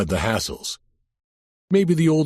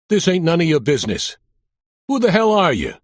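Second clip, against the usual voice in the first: put-on thick Brooklyn accent, raised higher and louder; tough-guy Brooklyn, pushing back hard; Sal Ventry's Brooklyn accent; 6 semitones higher, 4 dB louder than usual. Mobster warning off the cop, in a thick Brooklyn accent. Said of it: "with a thick Brooklyn accent".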